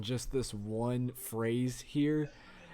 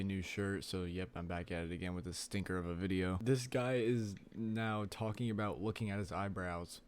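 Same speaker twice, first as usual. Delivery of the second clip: ordinary talk, nothing marked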